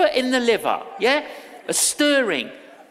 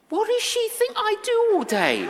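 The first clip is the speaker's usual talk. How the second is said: pitched way up, laughing in disbelief; a mock-baffled squeak at her request; incredulous thought; 12 semitones higher than usual. Preacher mocking his own thought, high and laughing.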